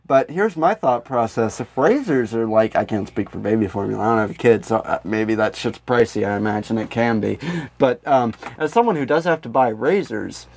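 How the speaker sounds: normal talking voice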